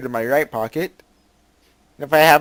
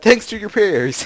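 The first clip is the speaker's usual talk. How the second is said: slow, sarcastic, higher; mock pious reply; 7 semitones higher, 7 dB louder, slower than usual. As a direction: lay the sarcasm on thick. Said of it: "Maybe I'll even add".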